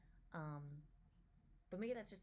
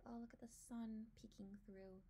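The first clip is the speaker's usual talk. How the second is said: ordinary delivery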